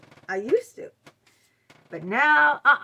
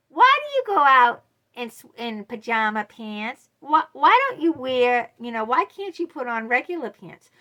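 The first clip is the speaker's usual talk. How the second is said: nagging friend; 3 semitones higher, 8 dB louder than usual. Retold or imitated. Friend's friend grilling you by message, mocking prying tone. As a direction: needle her like a nosy busybody. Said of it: "is sending you messages like".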